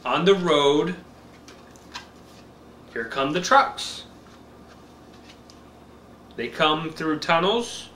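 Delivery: slow, bright picture-book reading; reading to a toddler. Acted. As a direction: read it slow for a little one.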